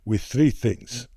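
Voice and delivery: imitating an Italian father, deliberate pauses; accented, measured dad voice; old man impression. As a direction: count out life lessons like a philosopher dad. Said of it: "this is a very good impression".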